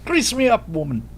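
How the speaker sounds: put-on high, loud Scottish accent dropping at the end; rough attempt at a Scottish accent; Scottish Groundskeeper Willie. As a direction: call it out in a put-on Scottish brogue. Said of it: "I gotta work on my Scottish".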